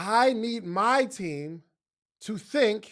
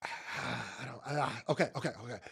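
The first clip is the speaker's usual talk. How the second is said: sighing, low, muted stammer; contained frustration; 9 semitones lower, 11 dB quieter than usual. Shown as a demonstration. Speaker avoiding saying something out of frustration, contained and quiet. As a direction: hold it in with quiet, reined-in okays. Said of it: "I have this frustrated energy".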